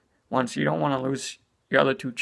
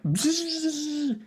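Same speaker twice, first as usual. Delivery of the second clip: high, slow buzzing noise like a toy rocket; drawn-out high zzz of a toy; 13 semitones higher than usual. Retold or imitated. Kid's toy rocket, imitated buzzing hum. Kid playing with noisy toy.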